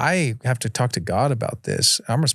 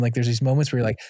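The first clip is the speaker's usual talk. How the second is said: ordinary talk, nothing marked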